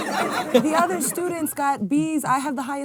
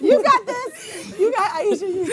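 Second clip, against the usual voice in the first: cheering; 10 semitones higher, 6 dB louder than usual. Real, not acted. Cheering on a friend.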